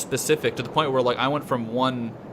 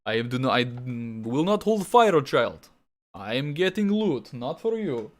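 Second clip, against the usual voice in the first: lightly put-on Russian accent, slow and deliberate; a hint of stern, heavy Russian; deep Russian accent; slower than usual. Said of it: "going full fucking deep Russia".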